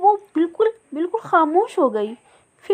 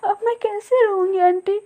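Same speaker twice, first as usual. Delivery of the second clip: put-on much higher, sobbing child's voice; a frightened orphan begging for answers; the little bird weeps for her lost mother; crying little girl; 6 semitones higher than usual. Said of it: "वो बहुत रोने लगी".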